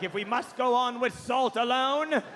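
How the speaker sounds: mock-heroic resolve, swelling on the repeat; Patrick Stewart. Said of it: "And he was like".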